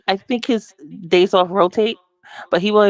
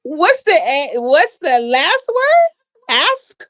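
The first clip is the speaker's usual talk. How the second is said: high, slow, loud disbelief; incredulous; 10 semitones higher, 4 dB louder, much slower than usual. Real, not acted. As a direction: stretch it out in disbelief. Incredulous at code word.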